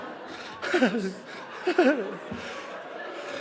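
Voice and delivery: a real laugh through tears; tearful laugh. Real, not acted. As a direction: laugh it off while choking up.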